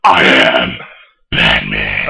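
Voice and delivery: deep, rasping Batman whisper; low gritty I am Batman. Acted.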